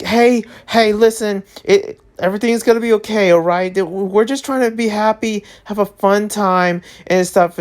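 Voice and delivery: high, placating interviewer voice; soothing news host. Retold or imitated. News interviewer reassuring a panicking guest, high and placating. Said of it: "the news people that was interviewing him was like".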